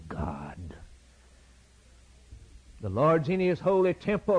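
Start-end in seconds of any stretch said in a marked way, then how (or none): 0.1-0.6 awed hushed whisper, slowed. Real, not acted. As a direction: drop to a reverent breath. Hushed ending of preaching list.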